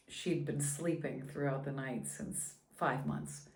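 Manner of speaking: ordinary delivery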